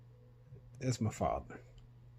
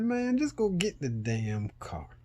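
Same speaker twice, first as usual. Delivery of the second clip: slow, higher, fed-up plea; exasperated; 7 semitones higher, slower than usual. Retold or imitated. Son fed up with OnStar refusing the tow, exasperated. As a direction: drag it out in disbelief. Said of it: "And I'm like".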